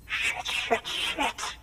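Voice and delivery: high, sharp burst of swearing; frustrated cursing. Acted. Survivor cursing at the porter's bad news, frustrated and high. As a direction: spit out the curses in dismay. Survivor panics mid-sentence.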